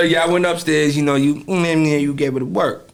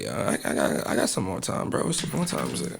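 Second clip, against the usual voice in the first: hushed mock plea; 9 semitones lower, 10 dB quieter than usual. Imitating himself begging for time.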